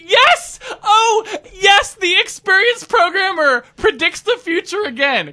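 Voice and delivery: gleeful high-pitched crowing; triumphant shout. Pretend. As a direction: crow it like you won the lottery. Mock triumph at own prediction.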